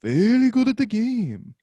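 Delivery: high-pitched put-on voice; hammed-up silly boast; spoof character boast. Podcaster answering the Sudoku joke, put-on character voice.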